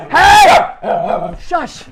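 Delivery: sudden high, loud yell to hush; yelling at dogs. Real, not acted. Owner yelling at noisy dogs, loud and high.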